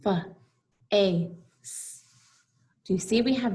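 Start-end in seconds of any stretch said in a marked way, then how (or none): 0.1-1.4 "F-A-C-E" as three separate sounds, fuh, ay, sss; sounded out. Shown as a demonstration. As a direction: break "F-A-C-E" into sounds with pauses between. Teacher sounds out the word face.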